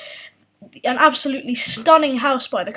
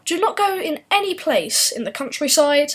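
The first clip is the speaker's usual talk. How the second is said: normal talking voice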